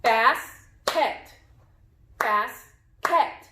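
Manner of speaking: "Basket" in two even beats, bas, ket; clapped syllables. Shown as a demonstration. Teacher claps the word with pupils, "Basket" split bas, ket. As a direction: chop "Basket" at the syllable break with a pause. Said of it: "Let's clap the word".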